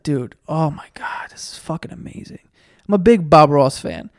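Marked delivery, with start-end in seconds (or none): 0.0-2.4 hushed, amazed whisper; awed whisper. Retold or imitated. Whispering in awe at a Bob Ross picture. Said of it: "I was like".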